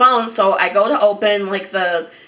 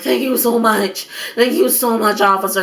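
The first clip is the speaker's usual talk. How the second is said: tearful gratitude; 2 semitones higher than usual. Retold or imitated. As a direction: thank the officer through pretend tears.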